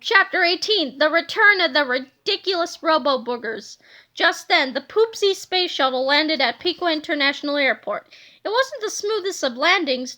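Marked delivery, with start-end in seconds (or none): none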